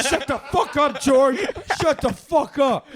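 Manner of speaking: high, loud yell breaking into laughter; frantic shout. Retold or imitated. Stopping George from telling everyone, shouting frantically. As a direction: act out desperately silencing a friend. Silencing George in acted quarrel.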